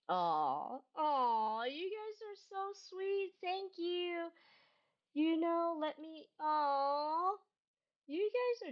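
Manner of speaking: tender aw; touched aw. Real, not acted. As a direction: coo at sweet viewers. Touched by kind chat.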